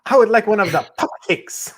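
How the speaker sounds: ordering in a high, fast put-on voice; mock ordering. Shown as a demonstration. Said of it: "You gotta order it that way too".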